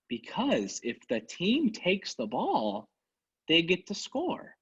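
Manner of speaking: put-on slowly, like explaining to a child; slow and condescending. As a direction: drag it out mockingly. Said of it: "I'm gonna say it very slow so you'll understand".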